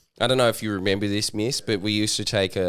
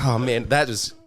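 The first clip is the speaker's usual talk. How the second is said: usual speaking voice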